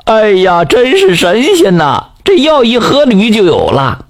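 高声欢呼，拖长了喊; 喜出望外地大声叫; 欢喜地直喊. A narrator's reading. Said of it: "抱着毛驴脑袋就直喊". (老王头抱着驴头高声欢呼)

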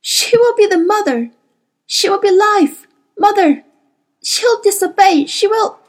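high, loud agonised outburst; frantic confession, pitched high; anguished gasping. A narrator's reading. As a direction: force the words out in torment. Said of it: "Lena Felt gasped".

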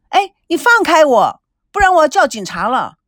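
high, loud demand; struggling protest. A narrator's reading. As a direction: threaten while fighting to break free. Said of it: "孙娜努力挣扎". (挣扎着大声抗议)

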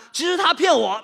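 loud, high, fed-up shout; indignant shout. Acted. Telling Gouzi the foreigner cheated him, shouting. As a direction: blurt it out, outraged.

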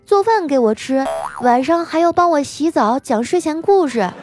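put-on high-pitched precocious child voice; unfazed little boy. Nephew calmly warning the waitress, childish voice. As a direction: reason it out like a composed small child. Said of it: "淡定地对服务员说".

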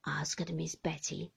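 ordinary delivery

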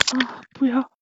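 hammed-up moans building to the end; mock moaning. Streamer on the last forfeit take, mock moaning. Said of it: "他最后还给自己又加了个十".

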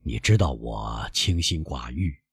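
put-on low, slow old man; modest old father. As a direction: gently play it down, unhurried and low. Father makes light of want.

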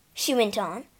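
ordinary delivery, nothing marked

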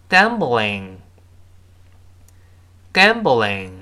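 "gambling" drawn out slowly with a light front l; model pronunciation. Shown as a demonstration. Teacher reads the word for learners to repeat, slow model pronunciation. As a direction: say "gambling" slowly, tongue forward on the l. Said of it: "我们再读一下这个分词".

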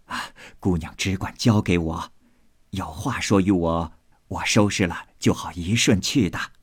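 put-on low, obliging old nanny; old servant woman. Old nanny accepting an errand, low and obliging.

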